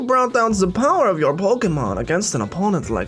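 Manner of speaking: high drawn-out hums, then slow admiration; put-on voice of an intrigued onlooker; musing scientist, admiring. Scientist praises the trainer's battle, musing and hammed-up.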